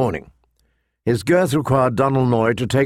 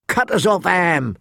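ordinary delivery, nothing marked

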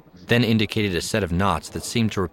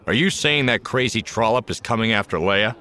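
gruff Han voice; 5 semitones higher, 4 dB louder than usual. Acted. Han voiced, alarmed and angry.

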